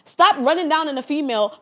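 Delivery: normal talking voice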